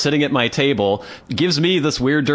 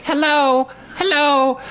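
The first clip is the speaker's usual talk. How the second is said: put-on very high piping child voice; toddler girl; slower than usual. Toddler climbing up at his table, squeaky greeting. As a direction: chirp it like a little girl. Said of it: "Was like".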